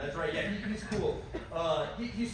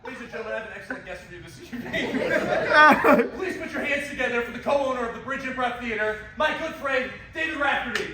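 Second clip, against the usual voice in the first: 1 show host intro; 6 semitones higher, 8 dB louder than usual. Acted. Introducing guest on stage.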